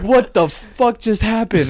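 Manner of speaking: shocked. Retold or imitated. Waking from the nightmare, loud startled disbelief. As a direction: blurt it out, slow and stunned.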